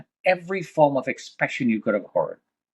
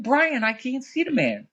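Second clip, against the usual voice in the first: much higher, mildly yelled retold cry; retold scream; 11 semitones higher than usual. Yelling to Brian in the smoke, high mild mock yell. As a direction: call out in alarm, not at full force. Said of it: "I'm screaming".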